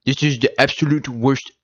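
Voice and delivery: high, slowed, nasal-free mock nerd voice with drawn-out ending; comic book guy impression. Mocking a podcast as the comic book guy. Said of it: "You know, the comic book guy?".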